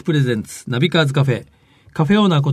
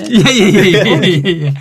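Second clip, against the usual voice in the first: laughing; 4 semitones higher, 6 dB louder than usual. Real, not acted. Laughing denial.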